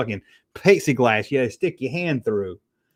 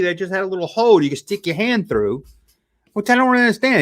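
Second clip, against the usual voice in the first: ordinary delivery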